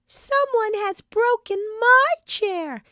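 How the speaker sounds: very high little bear squeak; squeaky Baby Bear. A narrator's reading. Baby Bear squeaks over his broken chair. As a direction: squeak it out like a tiny cub. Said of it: "squeaked Baby Bear".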